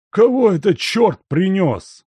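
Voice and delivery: put-on high, loud old servant swearing; cursing grumble. A narrator's reading. Old servant cursing the visitor at the door, loud and cross. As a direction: curse whoever is ringing the bell. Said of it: "ругался Лука".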